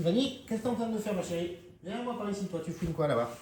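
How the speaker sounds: talking to the dog. Real, not acted. Parle à sa chienne Vanny. (voix aiguë et douce pour parler à sa chienne)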